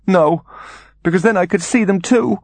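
high-pitched anguished plea; acted tearful dread; sobbing. A narrator's reading. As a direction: protest in a high, distressed voice. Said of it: "his mother sobbed".

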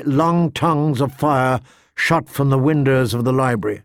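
ordinary delivery, nothing marked

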